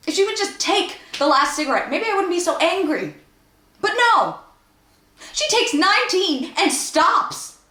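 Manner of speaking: high, mounting exasperation breaking loose on "But no!"; exasperated rant. Acted. Monologuist fed up with Wendy taking nineteen cigarettes, exasperated.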